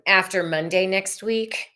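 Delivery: usual speaking voice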